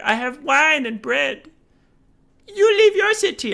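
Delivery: high, whiny mock Empire ally refusing to leave; whiny coward voice. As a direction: play a pampered lord who won't budge.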